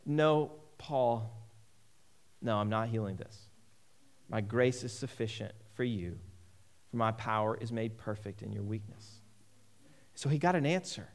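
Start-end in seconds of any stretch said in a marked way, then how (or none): 0.0-9.2 low, soft, measured voice of God; gently but firmly refuse, in a hushed, lowered voice; God answering Paul. Acted. God answers Paul's prayer for healing, low and soft. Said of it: "God said".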